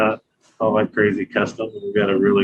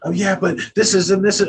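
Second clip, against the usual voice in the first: put-on high-pitched protesting kid voice; higher mock whine of a frustrated kid; imitating freaked-out son; 8 semitones higher than usual. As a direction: act out a child's flustered excuse. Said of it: "You're getting all freaked out".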